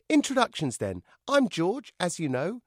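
high, loud puppet voice; cheeky puppet. A narrator's reading. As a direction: introduce yourself cheekily. Puppet George.

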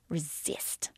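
a sharp breathy hiss, almost no voice; hissed whisper. Retold or imitated. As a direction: hiss the word at yourself as a warning. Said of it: "I hissed at my erogenous zones".

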